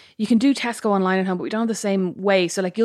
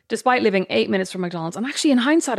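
normal talking voice

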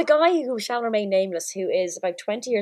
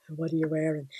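creepy caller voice; 4 semitones lower, 6 dB quieter than usual. Retold or imitated. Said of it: "heavy breathing".

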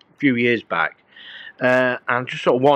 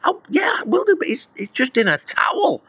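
defensive higher protest; 12 semitones higher than usual. Retold or imitated. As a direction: wave her off, flustered. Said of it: "I said".

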